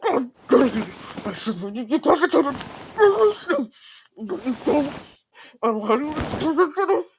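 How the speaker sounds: high, slow panicked laughing despair; nervous laugh. Acted. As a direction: laugh in disbelief at the trouble. Panicked self-blame, laughing.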